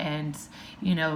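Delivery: ordinary talk, nothing marked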